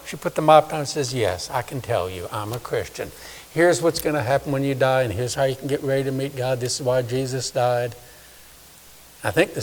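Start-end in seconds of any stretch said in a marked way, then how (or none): 1.1-7.9 soft, quiet, sure reply; calm scrub woman. Retold or imitated. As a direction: gently tell him the truth. Scrub woman answers calmly.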